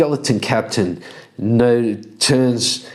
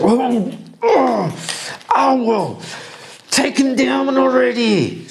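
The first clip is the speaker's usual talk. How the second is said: high whiny voice urging her on; high exasperated Ymir; 14 semitones higher than usual. Acted. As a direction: squeak it out, fed up with her. Ymir complains in character.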